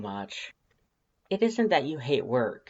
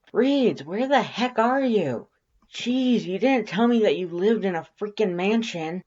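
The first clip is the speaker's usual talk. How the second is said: high, loud amazed yell; 9 semitones higher, 5 dB louder than usual. Acted. Undyne yelling to Reeds about the mansion, amazed. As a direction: holler it in awe from the entry. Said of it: "Undyne yells".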